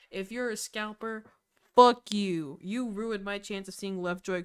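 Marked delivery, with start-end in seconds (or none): 1.7-2.6 loud, punched angry curse; pissed off. Real, not acted. Fan addressing the scalper who ruined her chance, pissed off. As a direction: hurl it straight at the culprit. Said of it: "I'm still so pissed".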